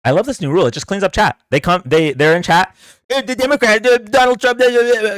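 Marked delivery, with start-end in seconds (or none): 3.1-5.1 higher, stammering dumb-chatter impression; mock flustered chatter. Mocking a chatter who can't find his words. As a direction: play the chatter fumbling for words, eh and uh.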